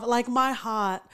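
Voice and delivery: ordinary delivery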